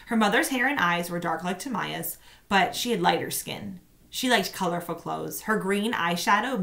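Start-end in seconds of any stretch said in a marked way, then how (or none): none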